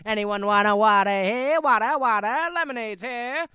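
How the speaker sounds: loud, high ballpark vendor hollering; stadium vendor call. Pretend. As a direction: bellow it across the stands. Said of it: "getting my last baseball calls in".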